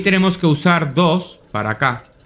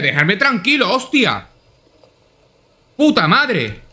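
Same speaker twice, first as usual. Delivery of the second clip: angry rant; 5 semitones higher than usual. Real, not acted. Out of ammo, swearing.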